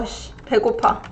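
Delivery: hushed aside; whispered grumble. Acted. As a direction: whisper a hungry complaint. Mock grumble about hunger.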